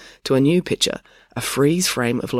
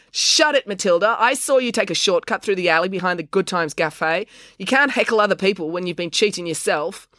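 raised voice, telling off a heckler; sharp retort; 6 semitones higher than usual. A narrator's reading. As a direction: put the heckler in her place. April snaps back at heckler Matilda.

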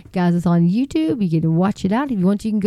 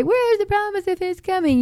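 mocking scoffer; 11 semitones higher than usual. Quoting end-times scoffers at his friend, mocking. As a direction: mimic the scoffers in a high sing-song. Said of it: "and they will say".

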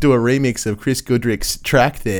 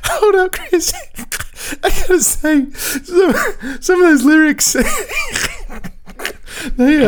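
barely holding back laughter; laughing. Real, not acted. Host fighting laughter over the song lyrics, squeaky and breaking. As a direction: voice cracking high with laughter.

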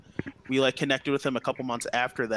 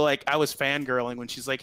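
usual speaking voice

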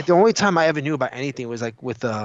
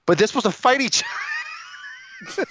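put-on high, slow childish yell of disbelief; kid's outraged shout; slower than usual. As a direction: shout it like a stunned little kid. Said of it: "I'm like a five, six, seven, eight-year-old Vince McMahon".

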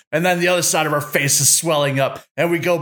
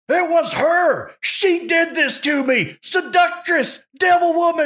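much higher, slow, hammed-up screaming of It was her; mock screaming accusation; 14 semitones higher, slower than usual. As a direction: wail the blame at the top of the voice like a cornered husband. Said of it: "you're screaming back and forth".

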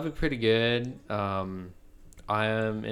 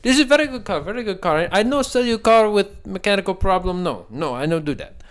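Indian accent impression; 10 semitones higher, 9 dB louder than usual. Salesman pitching the car, imitated Indian accent, loud and high. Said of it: "He's like".

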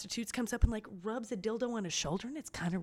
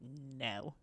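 lesser worker; 8 semitones lower, 10 dB quieter than usual. Retold or imitated. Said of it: "they're like".

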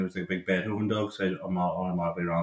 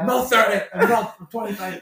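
high, loud mock outrage; indignant protest; 13 semitones higher, 8 dB louder than usual. Man mistaken for thirty, acting out indignant protest. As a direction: protest loudly, voice pitched right up.

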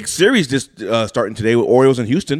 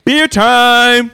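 announcer call; 11 semitones higher, 6 dB louder than usual. Acted. Announcing beer segment.

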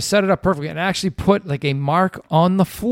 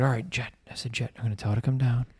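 low, soft, quick murmur; retold hushed plotting; 3 semitones lower, 8 dB quieter, faster than usual. Dad briefing his son on the proposal setup, hushed and low. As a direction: murmur the plan as if she might overhear.

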